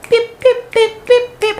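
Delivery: high imitated phone beeps. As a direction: beep like a text alert. Imitating phone notification sounds.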